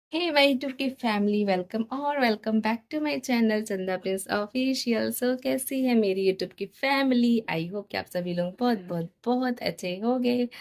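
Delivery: slow, lilting channel intro; vlog greeting. Acted. Vlogger hailing the YouTube family on arrival, sing-song vlog greeting. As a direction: stretch out the welcome in a sing-song lift.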